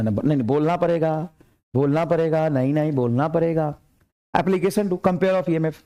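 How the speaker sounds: ordinary delivery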